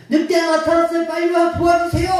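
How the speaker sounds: high, loud plea for help; desperate call. Acted. Shepherd boy begging villagers for help, desperate shout. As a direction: beg the village to come. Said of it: "라고 외치지만".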